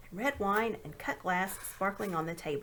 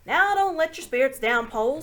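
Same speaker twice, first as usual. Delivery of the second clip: loud, higher, doleful marsh creature urging courage; gloomy Marshwiggle; 6 semitones higher, 11 dB louder than usual. A narrator's reading. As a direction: boom out a glum pep talk, pitched up. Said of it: "said the Marshwiggle".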